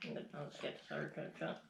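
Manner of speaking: quick muttered aside under breath; muttering. Real, not acted. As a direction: mutter it to yourself fast. Muttering aside while serving.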